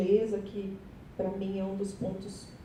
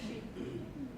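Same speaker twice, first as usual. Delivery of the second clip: nervous laugh; 7 semitones higher, 14 dB quieter than usual. Real, not acted. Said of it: "tô nervosa".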